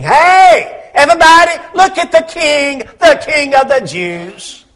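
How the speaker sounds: high, loud jeering call; hammed-up taunting shout, pitched high; mocking crowd. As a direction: call everyone over to jeer at a mock king. Acts out soldiers mocking the king.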